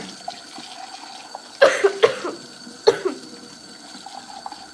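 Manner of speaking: long fit of dry coughs. Real, not acted. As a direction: keep coughing, throat itching. Why my throat is itching.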